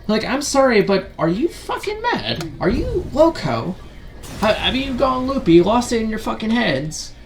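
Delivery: high-pitched, incredulous rant; exasperated disbelief. Real, not acted.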